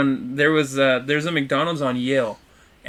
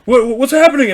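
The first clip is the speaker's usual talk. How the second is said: high, loud mock questioning; imitated anxious calling; 11 semitones higher, 9 dB louder than usual. Friend demanding news over the phone, imitated loud and urgent. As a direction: mimic someone clamoring down the phone.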